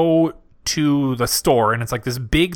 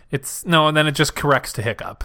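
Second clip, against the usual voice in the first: ordinary delivery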